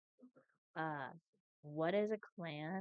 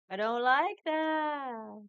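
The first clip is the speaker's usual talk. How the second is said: dramatic dismay; 7 semitones higher, 7 dB louder, much slower than usual. Real, not acted. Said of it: "Don't I sound like a good villain?".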